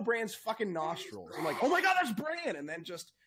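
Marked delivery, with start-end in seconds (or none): 1.6-2.6 high, loud fan squeal; thrilled fan recognizing Bran; excited squeal. Pretend. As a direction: gasp it out like you spotted him. Imagined fan reaction to nostrils.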